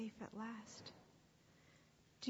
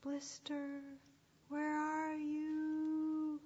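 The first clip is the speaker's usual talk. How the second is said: long, slow drawn-out searching call; high, stretched vowels, distant shepherd; faint, far-away call; 5 semitones higher, much slower than usual. Acted. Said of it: "It was faint and far away".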